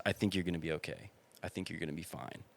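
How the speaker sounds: soothing reassurance. Retold or imitated. Calming a panicking kid.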